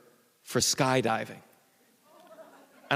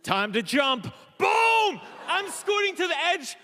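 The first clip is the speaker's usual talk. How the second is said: dramatic shout; 13 semitones higher than usual. Retold or imitated. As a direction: shout it like the big moment. Dramatic moment of the jump.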